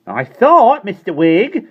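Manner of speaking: high, loud fussy landlady; prim housekeeper poking in; Miss Persimmon's voice. A narrator's reading. Landlady to her tenant, high and fussy.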